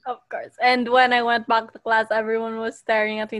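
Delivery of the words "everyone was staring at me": ordinary talk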